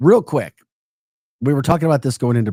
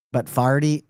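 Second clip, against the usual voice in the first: ordinary delivery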